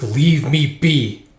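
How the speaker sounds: slow, drawn-out dismissive order; stern dismissal. Acted. Count sends away a servant, stern dismissal. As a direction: draw each word out to send him away.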